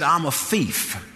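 slow, drawn-out imitated confession; tearful confession, retold. Woman after crying confesses to preachers, retold tearful confession. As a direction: draw out her admission, lightly imitating her. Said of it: "get her voice where she could say".

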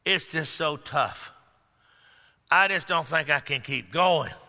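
low, soft, weary mock whine; imagined doubter complaining to the preacher, low and weary; discouraged complainer. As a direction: sigh it like someone ready to give up. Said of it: "somebody might say".

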